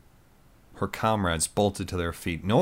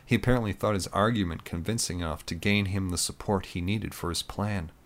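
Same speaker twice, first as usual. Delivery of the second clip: ordinary delivery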